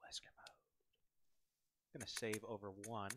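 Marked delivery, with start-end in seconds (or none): 0.0-0.6 breathy whisper. Shown as a demonstration. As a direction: whisper it under your breath. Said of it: "I can play Wesker mode".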